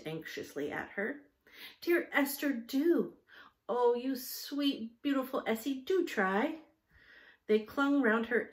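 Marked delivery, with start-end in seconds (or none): 1.8-6.6 higher, sweet wheedling plea; coaxing plea. Acted. The children coaxing Esther to try, higher wheedling plea. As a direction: wheedle her like a begging child.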